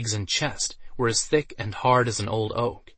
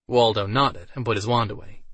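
usual speaking voice

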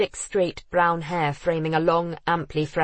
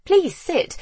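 high, louder hostess voice; flustered lady; 13 semitones higher, 6 dB louder than usual. A narrator's reading. Julia offering her guest a seat, high and flustered. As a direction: jump up high with a polite invitation.

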